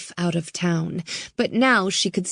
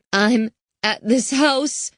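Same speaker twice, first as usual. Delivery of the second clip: put-on higher, slow girl's voice, shaken; a frightened girl on the phone; scared teen girl; 6 semitones higher, slower than usual. Girl calling Riley for help, higher and frightened.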